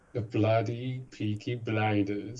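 slow, put-on Birmingham gangster accent; 1 mock Peaky Blinders Brummie accent. Friend answering with a slow mock Birmingham gangster accent. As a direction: drawl it like a Birmingham gang boss. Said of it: "By order of the Peaky Blinders".

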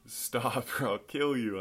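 hammed-up yell, held back and stretched out; mock yell. Pastor pretending to aim a gun, half-hearted yell. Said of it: "and I yell".

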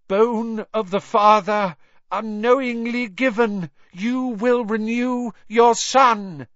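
high, loud, trembling incantation; shaking voice. A narrator's reading. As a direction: chant it in terror. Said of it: "His voice shook".

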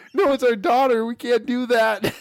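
lightly hammed-up insistence; mock protest. Voicing protective parents while amused.

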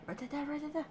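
high, quiet squeaky chant. Pretend. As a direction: squeak it out playfully. Playful high squeaky repeat.

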